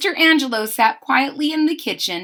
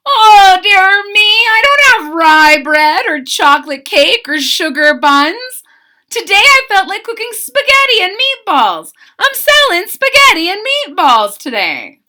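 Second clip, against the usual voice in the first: high, loud, sing-song flustered baker; a cheerful baker caught out, singing it; sing-songy Italian-style cook; fretful baker; 10 semitones higher, 9 dB louder than usual. A narrator's reading. Mister Angelo voiced in story.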